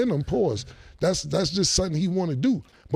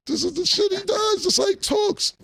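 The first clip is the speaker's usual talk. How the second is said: exasperated shout. Retold or imitated. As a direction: throw up your hands and shout it out. Retold exasperated outburst.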